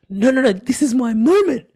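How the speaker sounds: high, loud mock-desperate objection; retold protest. Retold protest at being refused.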